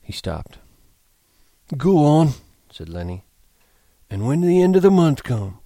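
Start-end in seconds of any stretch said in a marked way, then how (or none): 1.8-2.3 higher, loud prompt; eager Lenny. A narrator's reading. Lenny prompting George to continue, eager high voice. 4.1-5.6 high, loud, eager cue; feed him the line in a raised voice; eager Lenny prompt. A narrator's reading. Lenny prompting George's familiar speech, eager high voice.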